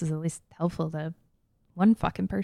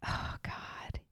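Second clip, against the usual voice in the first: whisper; 18 dB quieter than usual. Real, not acted. Groaning at the pirate joke.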